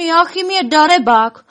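high, loud indignant wail. A narrator's reading. Elves blaming the hiding dragon, loud lament. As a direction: raise the complaint into a loud wail. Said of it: "naříkat ještě hlasitěji".

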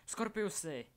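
high-pitched cry of the name. A narrator's reading. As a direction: call out in alarm to a hurt friend. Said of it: "Vykřikl Albus".

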